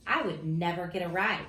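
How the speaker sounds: ordinary delivery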